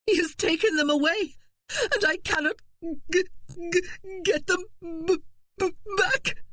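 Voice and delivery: high sobbing stammer; wail, breaking on each word. Acted. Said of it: "she was wailing".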